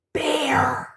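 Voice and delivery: breathy dramatic whisper; storyteller's spooky whisper. Acted. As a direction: hush the word for suspense. Dramatic reveal in picture book.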